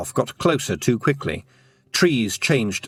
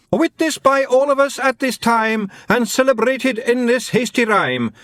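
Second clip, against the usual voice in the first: high, loud declaimed rhyme; hammed-up poetic declamation; shouted verse; 13 semitones higher, 3 dB louder than usual. A narrator's reading. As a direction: shout the rhyme after them. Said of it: "shouted after them".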